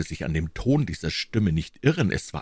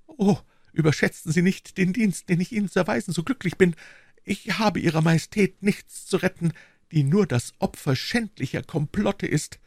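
put-on higher-pitched earnest young woman; devoted young servant woman; 13 semitones higher than usual. Young servant pledges herself to her queen, earnest and devoted.

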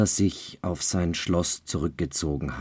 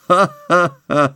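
loud high sinister devil laugh; dark laughter; 10 semitones higher, 8 dB louder than usual. A narrator's reading. Devil laughs at Childeret, sinister. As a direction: laugh darkly. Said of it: "mit finsterem Gelächter".

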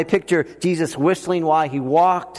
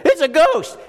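high-pitched frightened cry; mock scared sister; 10 semitones higher, 3 dB louder than usual. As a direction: squeal it like a terrified girl. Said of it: "Andrea is, like, scared of everything".

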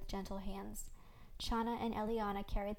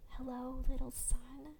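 soft gulp; 4 semitones higher, 3 dB quieter than usual. A narrator's reading. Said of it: "I gulped softly".

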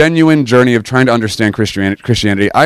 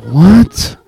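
high, incredulous yelp; stunned, sharply rising question; shocked disbelief; 7 semitones higher than usual. Retold or imitated. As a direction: blurt it out in mock shock. Acts out shocked reaction to paddle.

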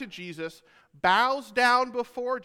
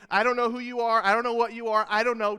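fearful pleading; 3 semitones higher, 5 dB louder, faster than usual. Acted. Frightened townspeople beg Jesus leave.

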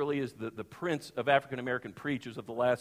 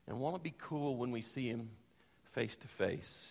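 soft reassurance; 3 semitones lower, 7 dB quieter than usual. Real, not acted. Soft reassuring sermon close.

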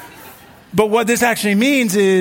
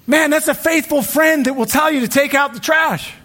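excited exclaim; 9 semitones higher than usual. Real, not acted. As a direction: call it out with delight. Preacher exclaiming about faithful friend.

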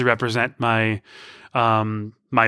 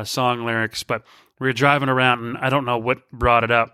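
ordinary delivery

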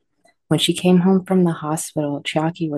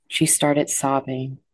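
ordinary delivery, nothing marked

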